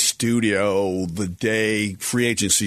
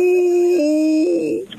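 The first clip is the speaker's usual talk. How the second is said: long high howl like a wolf; 4 dB louder than usual. Pretend. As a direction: throw your head back and howl. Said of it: "I got the Wolf howl".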